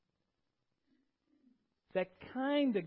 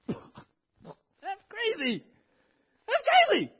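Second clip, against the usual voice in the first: high-pitched incredulous squeal breaking out of laughter; mocking laughter; 14 semitones higher, 9 dB louder, much slower than usual. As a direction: scoff in a high, hammed-up cackling voice. Mocking fishermen laugh at carpenter's order.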